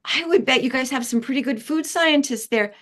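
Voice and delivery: mock flattery.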